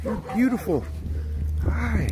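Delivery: high-pitched doting greeting; cooing at a dog. Real, not acted. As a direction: coo to the dog, pitched well up. Cooing to dogs.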